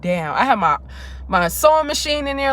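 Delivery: normal talking voice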